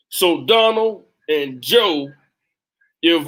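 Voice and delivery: ordinary talk, nothing marked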